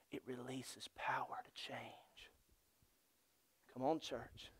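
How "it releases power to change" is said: hushed, very slow whisper; slow right down and whisper. Real, not acted. Preacher landing his point to the church, slow whisper.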